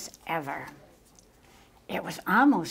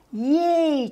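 high, loud cheer; 9 semitones higher, 6 dB louder than usual. A narrator's reading. Cub celebrates the snowfall, cheering. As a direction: whoop it out long and high. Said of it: "cheered Mungo".

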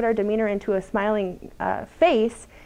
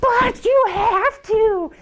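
much higher, very slow mock child voice; mocking sing-song; 11 semitones higher, 4 dB louder, much slower than usual. Mother mocking her tantruming daughter, exaggerated whiny voice. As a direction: tease her by copying her whine. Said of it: "I would go".

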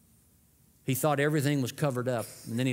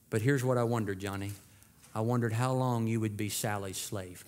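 gentle grandma; 3 semitones lower than usual. Retold or imitated. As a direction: speak lovingly to a guilty child. Grandma gently answers boy.

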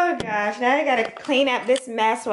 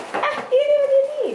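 helium voice; 12 semitones higher, much faster than usual. Real, not acted. Helium breath, squeaky fast.

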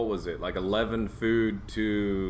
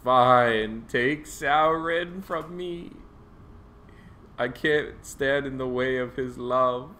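resigned role voice; 5 semitones higher, 4 dB louder, slower than usual. Pretend. Roleplaying a resigned character.

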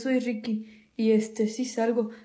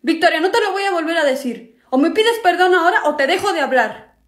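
stern demand; 5 semitones higher, 6 dB louder than usual. Acted. Older brother ordering Vic to apologize, stern and loud. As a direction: lay down the law to a little sister.

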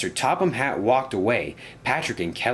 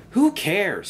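much higher, louder scornful retort; cross; 12 semitones higher, 4 dB louder than usual. Acted. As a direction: toss it off crossly. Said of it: "said Spencer crossly".

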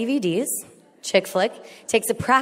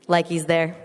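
normal talking voice

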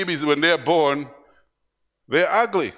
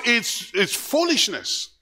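ordinary talk, nothing marked

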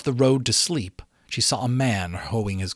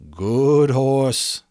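winded, louder coaxing; panting; 3 semitones higher, 6 dB louder than usual. A narrator's reading. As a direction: puff it out to the horse. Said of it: "the fat man panted".

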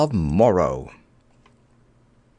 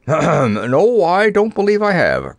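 throat-clear, then higher, louder nervous answer; nervous cough; 6 semitones higher, 4 dB louder, slower than usual. A narrator's reading. The Wizard answers Scraps about pills, coughing nervously. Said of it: "coughed the little man nervously".

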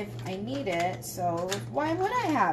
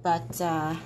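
ordinary delivery

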